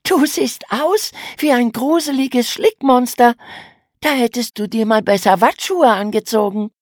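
hohe, lautere Stimme, frech stichelnd; neckende kleine Schwester. A narrator's reading. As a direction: den Bruder frech aufziehen. Emma neckt den Bruder. (Schwester neckt den Bruder, hoch und frech)

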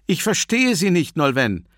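high, loud shouting into phone; shouting on bad phone line. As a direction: shout over a crackling line. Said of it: "In der Leitung knackte es fürchterlich".

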